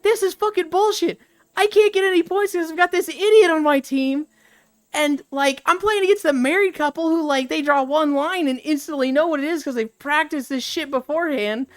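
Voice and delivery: high, loud imitated rage; retold furious gamer complaining about his teammate; mock rant. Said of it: "Stamper's like".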